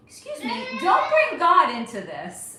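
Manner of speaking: indignant scolding. Real, not acted. Scolding a chat commenter.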